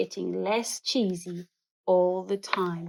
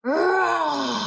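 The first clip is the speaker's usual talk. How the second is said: lion roar; 7 semitones higher than usual. A narrator's reading. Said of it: "it gave a great roar".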